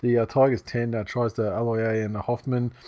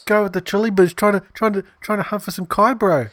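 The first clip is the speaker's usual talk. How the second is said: high, louder send-up of New Zealand vowels; mock Kiwi accent; 10 semitones higher, 4 dB louder than usual. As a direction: join in with a squeaky Kiwi caricature.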